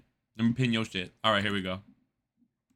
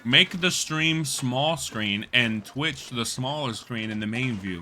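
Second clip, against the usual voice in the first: slow reading; slower than usual. Real, not acted. Reading chat message slowly.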